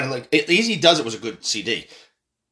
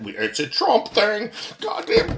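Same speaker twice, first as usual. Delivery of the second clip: a hammed-up anchor fretting over Trump; mock CNN anchor; 6 semitones higher than usual. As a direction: panicky parody voice, last word jumping way higher.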